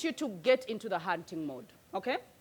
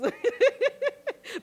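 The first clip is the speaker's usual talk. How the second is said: a real laugh, much higher; high giggle at the joke; 12 semitones higher than usual. Real, not acted. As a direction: break into a high laugh at yourself. Laughing at foolish Galatians.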